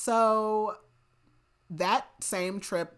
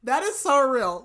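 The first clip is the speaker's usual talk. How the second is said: loud, high burst of delighted agreement; real excited outburst, amused; emphatic agreement; 7 semitones higher, 8 dB louder than usual. Real, not acted. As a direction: gush it loudly, drawing out the words. Gushing reaction to the nostalgic song joke.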